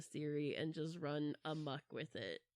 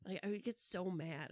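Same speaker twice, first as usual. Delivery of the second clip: ordinary talk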